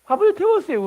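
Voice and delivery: high, crying bride's voice; tearful young bride. Retold or imitated. Young bride crying to her husband, high and tearful. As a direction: whimper it like a bride who burned the rice. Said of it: "울어요".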